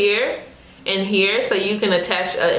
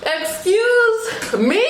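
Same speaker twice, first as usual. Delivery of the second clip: high, drawn-out disbelief with laughter; mock-indignant amazement; 10 semitones higher, much slower than usual. Real, not acted. Reviewer thrilled by the long wig, giddy disbelief. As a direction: stretch it out high, tickled and amazed. Amazed at forty-four inches.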